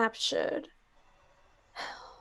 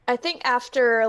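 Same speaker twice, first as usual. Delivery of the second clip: normal talking voice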